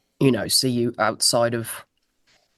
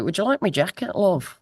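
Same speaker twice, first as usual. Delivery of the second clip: higher-pitched, badly faked Liverpudlian; 6 semitones higher than usual. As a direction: do a poor Liverpudlian impression, lifted in pitch. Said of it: "That's my Liverpudlian accent. It's terrible".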